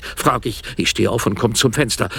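plain reading voice

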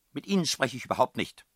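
high, loud refusal; angry snub. A narrator's reading. Headmistress refuses to talk with Karlchen, loud and cross. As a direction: cut him off indignantly.

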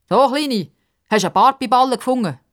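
mock her like a bully; bully Bruno sneering at Pippa; mocking taunt.

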